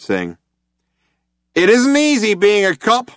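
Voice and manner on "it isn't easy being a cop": loud, high, hailer-blasted line; cop on a loud hailer. A narrator's reading. Cop answering through the loud hailer, loud. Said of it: "a voice replied".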